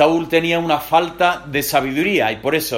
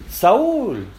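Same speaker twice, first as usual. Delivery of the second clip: calling out; 9 semitones higher than usual. Acted. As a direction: call him sharply by name. Samuel calls Saúl.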